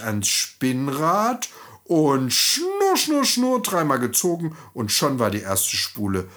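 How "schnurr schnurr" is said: high, playful whirring sound; spinning wheel whirr. Acted. The narrator imitates the spinning wheel for the children, high and playful. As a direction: hum it like a spinning wheel turning.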